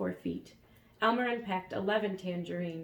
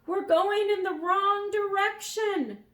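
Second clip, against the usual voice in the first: screaming; 12 semitones higher, 7 dB louder than usual. A narrator's reading. Dragon screams to Elmer that the wind is too strong. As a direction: scream it in desperate struggle. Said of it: "screamed the dragon".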